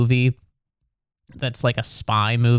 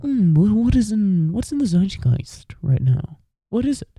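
high, affected mocking voice; mock a pretentious intellectual, pitched up high; put-on high snob voice; 8 semitones higher than usual. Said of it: "more pretentious sounding word than zeitgeist".

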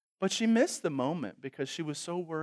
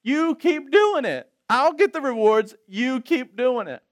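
high, loud, drawn-out mock cheering-on; acted crowd urging someone on, slowly; the rest of us; 8 semitones higher, 8 dB louder, slower than usual. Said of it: "That's the rest of us saying".

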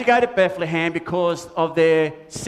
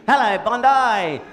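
loud greeting; 4 semitones higher, 3 dB louder, slower than usual. Acted. Puppet calling out to crowd.

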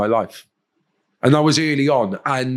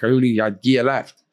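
ordinary talk

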